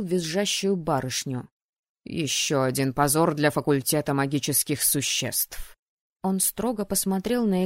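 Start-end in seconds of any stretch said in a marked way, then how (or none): 2.0-5.8 low, contemptuous official voice; cold verdict from a stern dean; dean's disdain. A narrator's reading. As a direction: pronounce judgement, pitched down. Dean scorns the screaming student.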